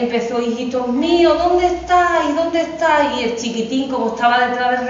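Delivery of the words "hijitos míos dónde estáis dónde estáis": mother calling. Acted. Mother goat searching for kids.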